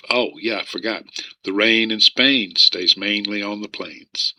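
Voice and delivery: normal talking voice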